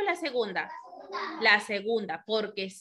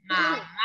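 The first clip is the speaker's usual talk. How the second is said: "Mamá" with the stress on the final "má"; acento en la segunda sílaba; 6 semitones lower than usual. Shown as a demonstration. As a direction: lean on the last syllable of "Mamá". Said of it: "¿Dónde suena más fuerte?". ("Mamá" con más fuerza en la última "má")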